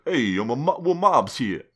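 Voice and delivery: cartoon mob tough guy; mobster voice. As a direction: ham up a New York wiseguy. Imagined mobster line.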